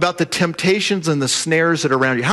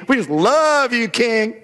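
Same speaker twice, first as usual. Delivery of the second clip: higher, syrupy fawning over the king; gushing flattery; 6 semitones higher, slower than usual. Retold or imitated. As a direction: lay it on thick like butter. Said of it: "sucking down the butter".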